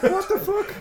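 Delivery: high, laughing mock bafflement; mock disbelief. Hero scolded for not being there, high baffled protest. Voicing hero's reaction to her nagging.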